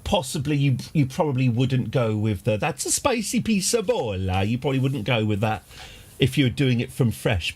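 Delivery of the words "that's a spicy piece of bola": put-on light Italian Mario accent, a touch higher. Quoting the game's Mario line in a mild Italian accent. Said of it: "the Mario voice".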